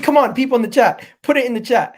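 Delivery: loud, high hype to chat; excited rallying call. Real, not acted. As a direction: whip up the crowd.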